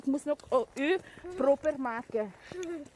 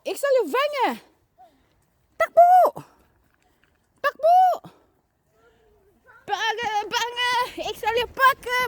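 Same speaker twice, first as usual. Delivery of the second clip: playful chase yelling; 9 semitones higher, 4 dB louder than usual. Pretend. Pretend chase with children.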